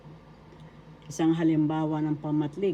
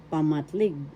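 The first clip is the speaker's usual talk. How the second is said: ordinary delivery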